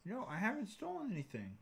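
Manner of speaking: high-pitched defensive denial; guilty-sounding. Real, not acted. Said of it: "That sounds pretty guilty".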